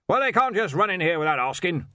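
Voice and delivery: loud, high, blustering archchancellor; blustery old wizard. Acted. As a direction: demand it like a bluff old master. Ridcully's voice.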